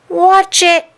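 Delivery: high, loud warning shout; boy's shout. Acted. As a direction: yell it at someone barreling past. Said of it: "a boy shouted".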